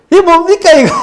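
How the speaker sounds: "Why is this happening?" high and pleading; retold shouting at God. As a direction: cry out in protest to God. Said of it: "하고 막 소리 지르고".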